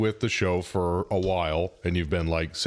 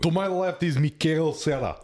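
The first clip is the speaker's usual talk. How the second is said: put-on Russian accent; 7 semitones higher than usual.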